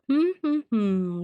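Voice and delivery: playful thinking hums; musing hums. Real, not acted. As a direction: hum while deciding. Musing over choices.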